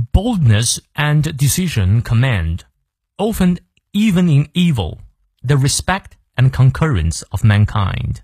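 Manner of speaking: slow, measured English recitation; deliberate teacher's read-aloud with clear pauses; model reading. Shown as a demonstration. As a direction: read it out slowly for learners, phrase by phrase. Full model reading for learners.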